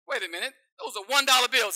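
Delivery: loud, high, quick outburst; indignant surprise. Acted. Young doctor seeing one dollar bills, loud indignant outburst. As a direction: protest the moment you notice.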